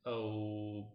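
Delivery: normal talking voice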